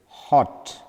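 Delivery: hot with a brief o; short open vowel in "hot". Shown as a demonstration. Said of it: "We pronounce like this".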